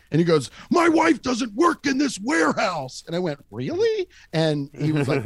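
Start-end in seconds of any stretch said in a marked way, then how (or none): 0.7-3.0 high, loud imitation of a furious boss; mock angry yell. Boss answering a question about his wife, imitated angry yell. 3.5-4.1 very high mock-curious question; feigned innocence. Storyteller needling his angry boss, high feigned innocence. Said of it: "act like you don't know why".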